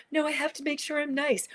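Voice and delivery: high-pitched, put-on niceness; mock nice-person protest. Imagined listener insisting on staying nice, mock high protest. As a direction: protest in a high, imitated people-pleaser voice. Mimics someone anxious to be nice.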